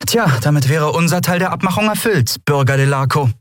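deutlich höhere, spitze Kapitänsstimme; Endriel, trocken. Acted. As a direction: betont freundlich, aber gereizt. Endriel als Figur. (Kapitänin Endriel zum Kunden, kühl und gereizt)

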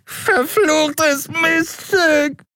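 very high, slow wailing insult with a cry; yowling. A narrator's reading. Dealer with broken nose cursing Endriel, yowling. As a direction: howl it clutching a broken nose.

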